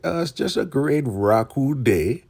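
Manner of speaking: high put-on elder voice; Elder Mother Marva. Elder makes small talk with Ruth, high put-on voice. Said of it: "she's just like".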